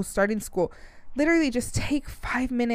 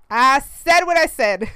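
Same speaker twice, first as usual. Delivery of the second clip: slow, louder, sassy repeat; sassy insistence; 3 semitones higher, 6 dB louder than usual. Real, not acted. Mom standing by her opinion, slow sassy emphasis. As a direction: dig in your heels, slow and loud. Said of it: "and I said what I said".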